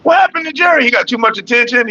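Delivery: jumps way up in pitch, astonished; a sudden high amazed question; surprised high exclaim. Real, not acted. Reacting to Jerry leaving.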